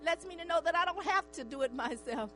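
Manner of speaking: normal talking voice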